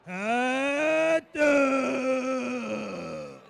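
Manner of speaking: long drawn-out vendor shout; hawker call. Pretend. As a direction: belt it across the stadium. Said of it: "your best hawker impression".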